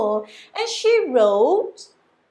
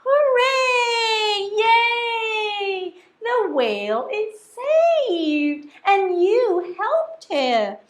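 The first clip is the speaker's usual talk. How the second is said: cheering; 7 semitones higher, 4 dB louder, much slower than usual. Acted. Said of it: "villagers shouting".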